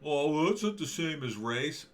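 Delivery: a hammed-up dismissive critic, then flustered; mocking woke critic. Mocking an imagined woke opponent.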